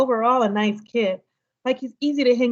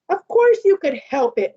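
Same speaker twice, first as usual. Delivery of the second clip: firm, louder scolding; 4 semitones higher, 5 dB louder than usual. Acted. Mom scolds her son for running from the boy. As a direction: tell him off sharply. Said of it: "Mom scolded".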